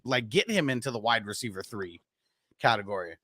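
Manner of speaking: usual speaking voice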